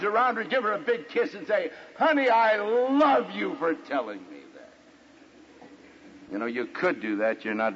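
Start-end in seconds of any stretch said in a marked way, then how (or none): 2.0-5.7 slow, high, gushing husband; hammed-up loving thanks, drawn out; mock-adoring. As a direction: overdo the sweet gratitude. Imagined grateful husband, hammed up.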